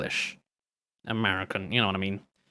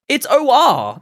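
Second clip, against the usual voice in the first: ordinary talk, nothing marked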